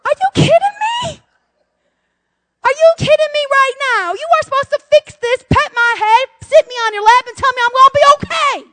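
hammed-up outrage at a husband; indignant outburst. Wife outraged her husband won't fix things, shrill shout. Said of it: "And I'm like".

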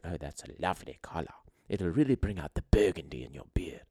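hushed, whispery British lady impersonation; mock British granny, quiet and breathy; old British woman's accent. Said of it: "an old British woman's accent".

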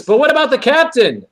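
high-pitched protesting question; mock-alarmed question; mock concern. Voices Uhura's objection in recap.

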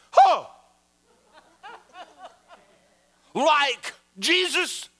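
high, loud, drawn-out mock outrage; hammed-up horrified gasp of a question; scandalized shock. Offended woman hearing he is God's son, hammed-up shock. Retold shock at his claim.

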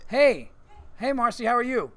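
high, loud, cheery greeting across the room; calling out hello. Real, not acted. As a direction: call out to a friend who just walked in. Greeting Marcy walking in.

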